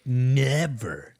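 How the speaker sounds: lightly mimicked drawn-out catchphrase; mocking mimicry. Mocking the comedian's stupid line, lightly mimicked. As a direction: echo his stupid delivery, lightly exaggerated. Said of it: "He did the stupid".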